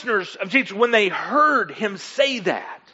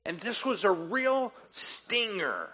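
ordinary talk

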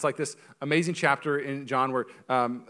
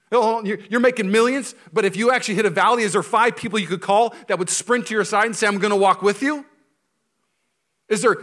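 loud, raised preacher's voice, driving forward; impassioned preaching; 8 semitones higher, 10 dB louder than usual. Real, not acted. Preacher challenging pastors on who stands by them, impassioned preaching. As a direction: press the congregation hard with each question.

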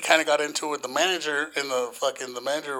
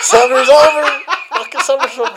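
high, loud, laughing repetition; amused mock yell; 9 semitones higher, 9 dB louder than usual. Repeats the outburst while amused.

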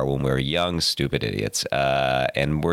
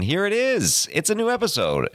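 excited; 13 semitones higher than usual. Acted. Host's excited show opener.

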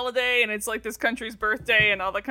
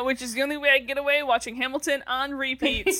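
normal talking voice

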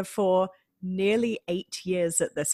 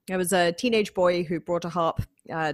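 normal talking voice

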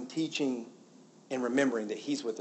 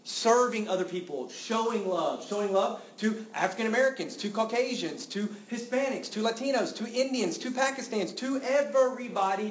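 building preaching; 7 semitones higher, 4 dB louder than usual. Real, not acted.

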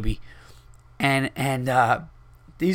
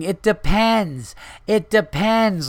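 higher, slow, drawn-out mock heckle; mock heckler yell; 6 semitones higher, 3 dB louder, slower than usual. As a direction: ham up a drunk woman shouting from the crowd. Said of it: "she was yelling".

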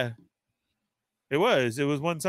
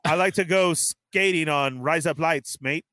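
put-on slow, broad Australian accent; put-on Australian accent for laughs. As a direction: play up a drawling Australian accent. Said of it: "do an Australian accent".

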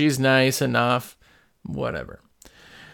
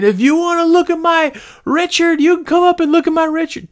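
mock taunting old man voice, pitched way up; old man yelling; 13 semitones higher than usual. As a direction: ham up a crazed naked old man hollering down. Naked old man yelling down stairs.

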